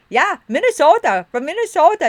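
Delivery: loud, high, hammed-up Minnesota twang; big booming Minnesota accent act. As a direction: gush like an over-the-top Minnesota tourist. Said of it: "I did use that, um, accent".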